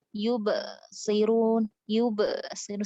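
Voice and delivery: yubsirun very slow with a gap after the b; wrong broken yubsirun. Shown as a demonstration. Teacher demonstrating the wrong yubsirun. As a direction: break yubsirun with a pause.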